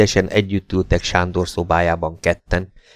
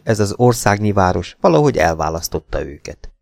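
ordinary delivery

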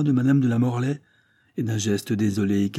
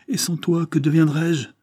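much higher, louder plea; impassioned cry; 8 semitones higher, 4 dB louder than usual. Acted. Henri to his father the duke, much higher impassioned cry. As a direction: cry out the question.

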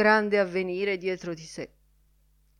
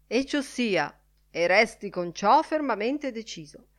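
higher-pitched chairman putting a motion; announcing; 7 semitones higher, 3 dB louder than usual. A narrator's reading. Lenihan puts the motion to the drinkers, announcing. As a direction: announce it to the meeting with lifted pitch. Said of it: "annunciò Lenihan".

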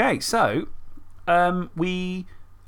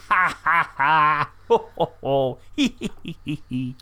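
maniacal villain cackle; hammy mad laugh, ha ho hee; faking madness; slower than usual. Acted. Host cackles madly before quiz.

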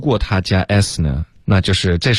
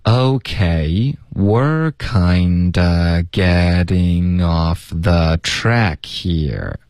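very slow, word-linking teacher model; slow linking demo; much slower than usual. Shown as a demonstration. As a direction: stretch it out for learners to follow. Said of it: "我们请老师来先示范一下". (放慢示范连读)

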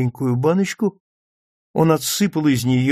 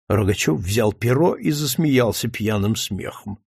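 plain reading voice

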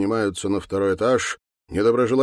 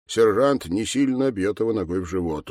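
normal talking voice

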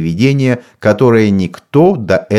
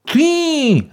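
high shrieking cry; witch's shriek. A narrator's reading. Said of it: "завопила ведьма". (пронзительный вопль ведьмы)

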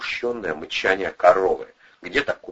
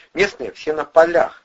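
know-it-all tone; 10 semitones higher, 8 dB louder than usual. A narrator's reading. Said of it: "тоном знатока откликнулся".

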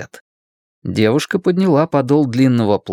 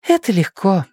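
higher-pitched female character; young woman's voice; 8 semitones higher than usual. A narrator's reading. Ann reassures inexperienced Blaise, high girlish voice. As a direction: answer high, as the girl.

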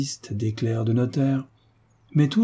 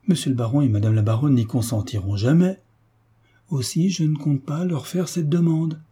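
plain reading voice

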